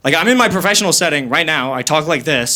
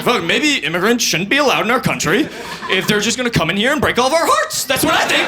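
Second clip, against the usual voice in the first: mock conservative rant; 7 semitones higher than usual. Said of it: "This is my conservative turn".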